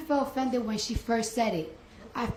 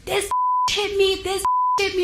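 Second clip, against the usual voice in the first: higher, mocking accusation in a hammed-up voice; mock frantic accusation; 9 semitones higher, 3 dB louder than usual. Mocking the guard shouting that she hit her, higher. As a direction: imitate the receptionist's panicked accusing. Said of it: "She started wilding out".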